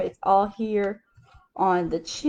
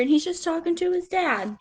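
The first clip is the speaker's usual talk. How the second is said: ordinary delivery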